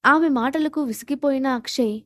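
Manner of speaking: ordinary delivery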